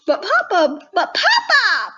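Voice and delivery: loud, high pleading; pleading cry. Acted. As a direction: beg papa out loud. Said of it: "Buttermilk cried".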